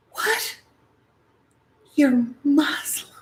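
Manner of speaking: high, startled shocked gasp of a question; mock terrified. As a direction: recoil at the news. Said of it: "being terrified".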